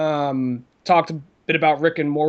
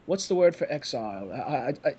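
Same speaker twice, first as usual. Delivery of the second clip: mock dry British accent; 3 semitones lower, 7 dB quieter than usual. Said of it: "a very dry British voice".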